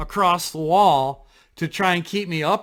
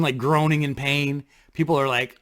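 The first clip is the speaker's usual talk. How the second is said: ordinary talk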